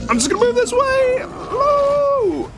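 very high running-away squeal; panicked high squeal. Real, not acted. Player fleeing the boss, very high squeal.

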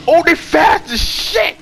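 high-pitched, stretched-out panic; alarmed cry. Real, not acted. Gamer seeing how fast the pursuers are, high panicked cry.

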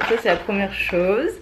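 ordinary delivery

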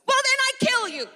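high, loud, fast hammed-up shout; mock angry threat. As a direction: blurt the threat in a huffy overreaction. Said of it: "with the same sort of attitude goes".

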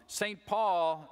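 normal talking voice

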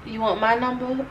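halting, understated opening line; nervous auditioner. Shown as a demonstration. Starting her audition monologue, nervously understated. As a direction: deliver the monologue opener uneasily. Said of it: "So I'm just like this".

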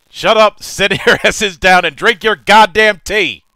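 loud, high barking rant; Sid impression. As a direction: yell it like a cranky gruff captain. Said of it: "I have to channel Sid from F F seven".

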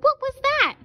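high alarmed question; shrill startled hen. A narrator's reading. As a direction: jump at the sound and ask. Startled hen looks around.

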